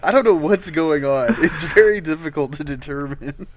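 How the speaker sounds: loudly puzzled, playing up the confusion; mock bewilderment. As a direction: act out a torn, confused moviegoer. Retelling friends' baffled reaction in theater.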